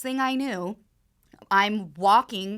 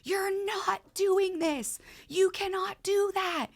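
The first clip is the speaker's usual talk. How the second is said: high-pitched frantic yell at his wife, slowing down; retold yell; 10 semitones higher, slower than usual. Husband pulling wife from the street, high panicked yell. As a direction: shout in sudden panic, pitch jumping way up. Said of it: "and he yells".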